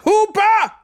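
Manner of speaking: very high, loud roar; angry bellow. A narrator's reading. Teacher yells at schoolboy Hooper, roaring. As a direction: bellow the pupil's surname in rage. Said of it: "brüllte Boyle".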